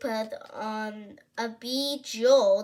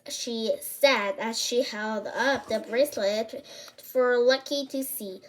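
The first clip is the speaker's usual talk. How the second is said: usual speaking voice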